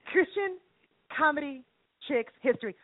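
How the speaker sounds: spaced-out words, pause after each; measured, piece-by-piece title announcement; deliberate announcement. Acted. Announces title, then repeats it faster.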